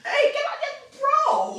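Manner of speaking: loud high yell at the dog; shouting. Real, not acted. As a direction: yell it out sharply. Yelling at the dog.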